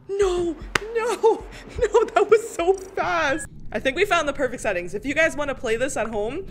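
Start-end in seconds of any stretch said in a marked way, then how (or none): 0.1-3.5 high laughing protest; laughing dismay. Real, not acted. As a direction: laugh through the no. Lost too fast.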